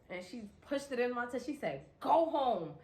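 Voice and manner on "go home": big sister order. Retold or imitated. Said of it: "on some big sister shit".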